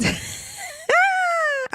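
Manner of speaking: high, choked laugh through tears; teary high laugh. Real, not acted. Catching herself crying on camera, high teary laugh. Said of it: "I went to full in emotional mode".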